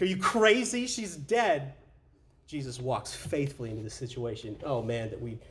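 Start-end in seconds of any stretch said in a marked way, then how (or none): 0.0-1.7 higher and louder scoffing; mocking disbelief. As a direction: scoff at the idea.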